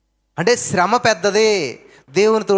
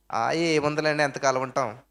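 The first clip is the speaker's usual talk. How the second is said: low, softer shrug of a doubter giving up on life; resigned mock sigh; 6 semitones lower, 7 dB quieter than usual. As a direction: mimic a listener who has already given up, voice sinking. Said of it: "అంటున్నారా?".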